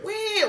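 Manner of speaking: high-pitched fake wah; mock crying wail. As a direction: imitate the dealer bawling. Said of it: "She went".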